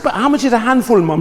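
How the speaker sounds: high, insistent mock child's question; pestering child. Son asking mum what a handful is, pestering. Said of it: "I said".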